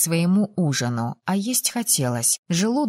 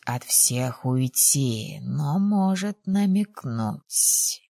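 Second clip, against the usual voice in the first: low, slow, hushed confidant; soft deep-pitched insinuation, drawn out; Kosha's knowing voice; 6 semitones lower, 3 dB quieter, slower than usual. A narrator's reading. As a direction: advise her privately in a lowered, unhurried voice. Kosha's private voice to heroine.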